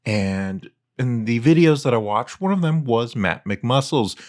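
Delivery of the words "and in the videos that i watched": ordinary delivery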